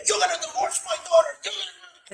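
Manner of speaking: high, loud retold yell; screaming. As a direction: scream it as the enraged father-in-law. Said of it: "yelling at him and screaming at him".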